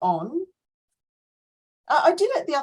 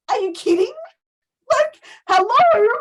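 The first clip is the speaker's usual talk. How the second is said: incredulous; 12 semitones higher, 7 dB louder than usual. Retold or imitated. Coach reacting to her client, higher incredulous hello. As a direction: act stunned someone missed the obvious. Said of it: "I'm just sitting there and I'm like".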